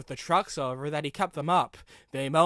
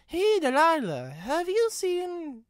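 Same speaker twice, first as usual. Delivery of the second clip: much higher, slow, uncertain; Riley asking the brake van; worried Riley; 13 semitones higher, 5 dB louder, slower than usual. A narrator's reading. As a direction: trail off unsure.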